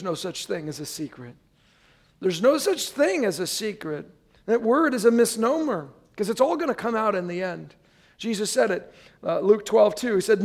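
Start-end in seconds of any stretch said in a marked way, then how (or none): none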